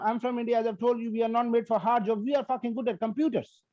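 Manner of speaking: ordinary talk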